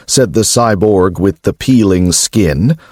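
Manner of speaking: plain reading voice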